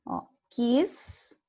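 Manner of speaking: ordinary talk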